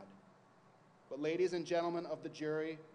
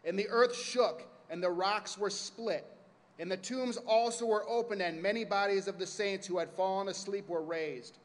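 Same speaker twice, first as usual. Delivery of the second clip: dramatic scripture reading; 3 semitones higher, 6 dB louder than usual. Acted.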